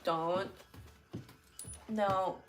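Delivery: ordinary delivery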